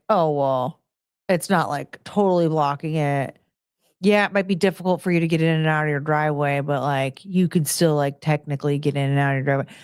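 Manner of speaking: low, fast, dismissive mock voice; mocking neighbor voice. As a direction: brush it off like a smug neighbor. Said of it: "and just be like".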